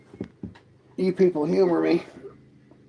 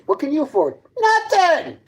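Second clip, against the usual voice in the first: higher, with Nothing shooting way up; high mocking taunt; 11 semitones higher than usual. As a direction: sneer it up high. Taunting viewers about money.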